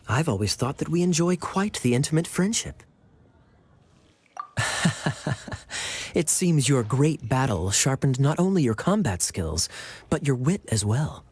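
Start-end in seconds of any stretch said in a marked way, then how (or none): 4.3-6.1 quiet airy laugh; soft chuckle through the nose; soft breathy chuckle. Acted. Kaeya amused by retort.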